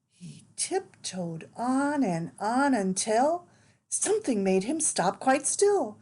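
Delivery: put-on lively read-aloud for small children; animated picture-book narration; storybook reading. A narrator's reading. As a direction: read it like bedtime story time.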